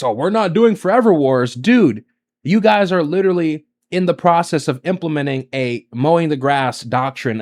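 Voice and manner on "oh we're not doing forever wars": a lightly put-on, sing-song mock of the politician; mocking J D Vance. A commentator mocks the vice president's claim, lightly sneering. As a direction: sneer it as a hollow promise. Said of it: "from J D Vance".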